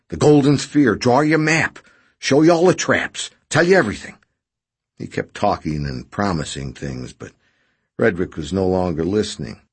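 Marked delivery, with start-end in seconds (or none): 0.1-4.2 high, loud, climbing list of promises; desperate pleading. A narrator's reading. Injured man promising his rescuer everything to get out, desperate. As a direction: beg urgently, each offer higher.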